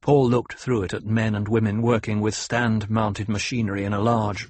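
plain reading voice

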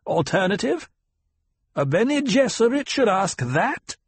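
high, scornful disbelief; mocking old teacher; incredulous old matriarch. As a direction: scorn her for asking. Reverend Mother voiced.

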